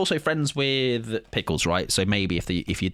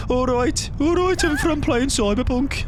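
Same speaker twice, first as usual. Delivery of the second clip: Brummie accent; 13 semitones higher than usual. Shown as a demonstration. Scottish streamer showing off his only accent, a Brummie. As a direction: ham up a Birmingham twang. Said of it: "The only accent I can do is".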